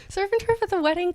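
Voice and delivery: can't believe it, rising outrage; incredulous. Real, not acted. Reader balks at surf and turf, incredulous and loud.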